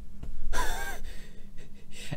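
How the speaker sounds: high breathy burst of laughter. Real, not acted. Laughing at a photo of a seller in underwear, breathy laugh. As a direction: let a real laugh escape.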